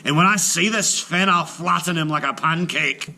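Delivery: loud boasting giant threat; giant, threatening. Acted. Giant vowing to flatten Finn, loud boast. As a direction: puff up and threaten, giant-sized.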